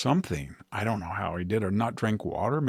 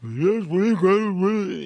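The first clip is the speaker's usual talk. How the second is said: higher, louder put-on Brooklyn accent as Bernie; mock Bernie accent; 8 semitones higher, 5 dB louder, slower than usual. Bernie rallying senators in a mock Brooklyn accent. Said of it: "Bernie gets that awful accent".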